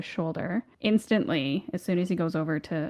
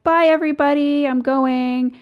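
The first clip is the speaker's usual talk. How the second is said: higher, louder sing-song farewell; cheery goodbye; 8 semitones higher, 7 dB louder than usual. Retold or imitated. Leaving the party, loud cheerful goodbye. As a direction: call out a bright goodbye to the room. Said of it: "And I was like".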